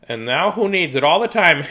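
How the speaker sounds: a hint of the old teacher gloating on stage; teasing old teacher. Teacher's punchline quote.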